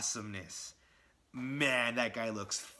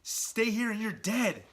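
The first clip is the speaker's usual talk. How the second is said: higher, louder urgent warning; urgent hero; 9 semitones higher, 5 dB louder than usual. Retold or imitated. Spider-Man urging himself to run, high loud alarm. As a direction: shout at yourself to get moving. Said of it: "He's like".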